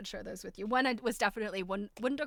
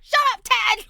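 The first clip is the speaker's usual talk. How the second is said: exasperated full yell, much higher; 13 semitones higher, 17 dB louder than usual. Retold or imitated. Woman yelling across at her talking computer, exasperated shout. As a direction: yell it out to hush a chattering intruder. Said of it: "I yelled from the other".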